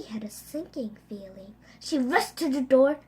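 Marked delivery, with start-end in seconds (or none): 0.0-1.5 ominous whisper. A narrator's reading. Ominous narration moment.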